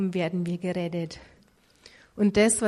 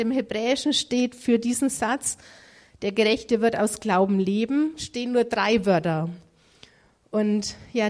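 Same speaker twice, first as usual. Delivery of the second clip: ordinary talk, nothing marked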